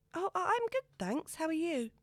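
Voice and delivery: high, stumbling, trying to sound cool; nervous girl picking up his call; flustered excitement. A narrator's reading. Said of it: "desperately trying to keep it cool".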